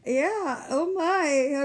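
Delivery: high, loud echo of amazement; amazed. Real, not acted. Host echoing the guest's story, loudly amazed. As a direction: share the astonishment loudly.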